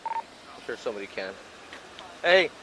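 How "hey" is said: loud urgent shout across the scene. Real, not acted. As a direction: call out over the noise. Calling out to fellow officers.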